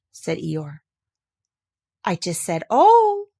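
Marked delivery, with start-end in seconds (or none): 2.0-3.3 nervous. Acted. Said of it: "said Piglet nervously".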